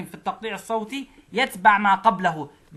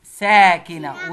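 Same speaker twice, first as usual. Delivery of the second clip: ساكنة drawn out slowly and louder; emphatic stress; 3 semitones lower, 8 dB louder, much slower than usual. Shown as a demonstration. Teacher stressing ساكنة so the pupil remembers. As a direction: lean hard on ساكنة. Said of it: "خلي بالك الأولى إيه".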